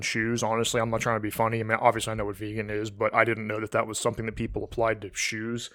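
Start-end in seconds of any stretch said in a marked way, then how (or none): none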